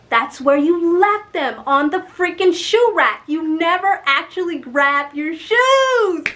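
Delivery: loud, high, drawn-out frustrated outburst; exasperated self-scolding. Retold or imitated. Woman scolding herself over forgotten heels, exasperated. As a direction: scold yourself loudly, stretching the last word.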